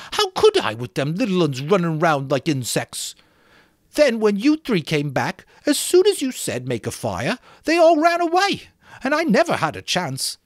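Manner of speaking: put-on high, far-off fretful boy's voice, well above the narrator; Piggy's whiny voice. Piggy scolding the boys for rushing off, high indignant boy voice.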